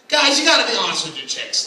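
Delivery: normal talking voice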